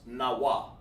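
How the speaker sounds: "Nawa" as two distinct syllables, na and wa; two sounds. Shown as a demonstration. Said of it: "but I hear two sounds".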